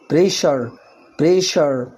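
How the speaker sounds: بريشر said presher with sh; sh in pressure. Shown as a demonstration. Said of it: "هتنطق شين".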